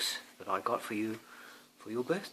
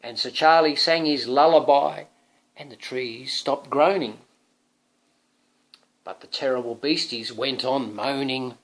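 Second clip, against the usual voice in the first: louder, lively telling; storybook reading; 8 dB louder than usual. Acted. Parent reading to Thomas, storybook voice. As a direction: carry the story forward.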